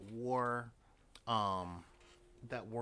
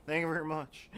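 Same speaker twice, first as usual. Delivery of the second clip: mock [name] sign-off, loud; 3 semitones higher, 7 dB louder, faster than usual. Comic capping the sung parody for the audience, mock [name]. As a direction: toss off a hammed-up [name] thank-you. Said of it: "just do an [name]".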